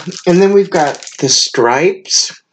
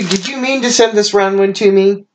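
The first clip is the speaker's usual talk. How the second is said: usual speaking voice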